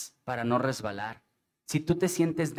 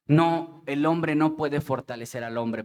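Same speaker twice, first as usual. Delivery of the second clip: usual speaking voice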